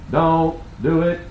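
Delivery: slow mock-grave "don't do it"; slow warning voice. Friend on the phone warning against the timeshare, slow and grave. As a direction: repeat the warning slowly, a hint of the friend. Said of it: "I heard him say".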